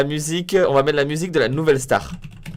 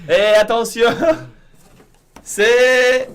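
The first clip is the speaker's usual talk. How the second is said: high, loud big reveal; excited announcer; 8 semitones higher, 8 dB louder, much slower than usual. Acted. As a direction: build up the reveal like a TV host. Annonce la révélation. (annonce de révélation enthousiaste)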